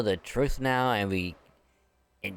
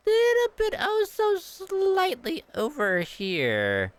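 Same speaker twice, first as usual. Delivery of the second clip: teasing sing-song; 4 dB louder, slower than usual. Pretend. Pointing out sleeping dragon.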